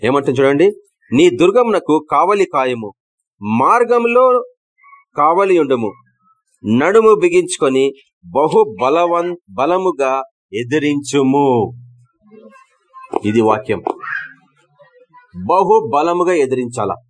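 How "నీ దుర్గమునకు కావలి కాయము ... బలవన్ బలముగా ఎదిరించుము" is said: rally the church to stand firm; thundering scripture proclamation; preaching at full force. Acted.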